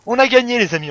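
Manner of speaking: loud, high burst of triumph; real victory cheer. Real, not acted. Player to viewers after winning, triumphant cheer. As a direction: shout the win to your friends.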